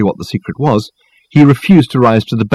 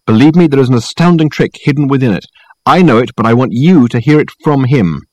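higher, forceful, angry insistence; angry snap; 6 semitones higher, 4 dB louder than usual. A narrator's reading. Dirk insisting Richard ask about the trick, angry. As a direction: press the order with heated urgency. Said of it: "he snapped angrily".

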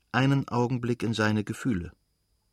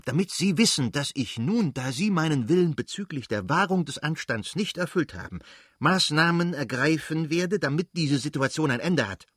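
cold fury in a raised voice; angry; 6 semitones higher than usual. A narrator's reading. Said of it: "fuhr er genauso zornig fort".

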